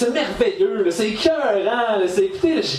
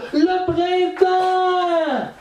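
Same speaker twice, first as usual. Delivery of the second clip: mock ecstatic cry; 12 semitones higher, much slower than usual. Mocking spring-crazy people.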